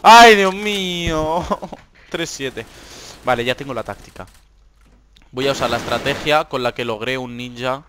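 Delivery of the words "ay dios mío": drawn-out louder groan of dismay; exasperated wail. Real, not acted. Gamer to viewers, exasperated drawn-out groan. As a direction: moan it long and loud, fed up. Frustrated repeated sigh.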